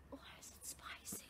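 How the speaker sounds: whisper. Real, not acted. Mouth burning from cinnamon.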